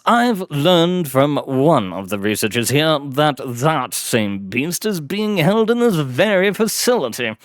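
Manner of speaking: triumphant gentleman hunter; exuberant aristocrat. Acted. Blackwood telling Deeds about the beast, exuberant aristocrat. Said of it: "exuberant, aristocratic tones".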